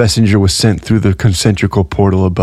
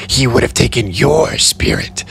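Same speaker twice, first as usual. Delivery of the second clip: unsettling; 4 semitones higher than usual. A narrator's reading. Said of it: "in a way that made Amory feel very uncomfortable".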